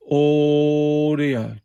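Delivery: drawn-out audio check into the mic; radio test call. Real, not acted. Testing his CB transmit, drawn-out radio check call. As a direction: call it out slow like keying a CB.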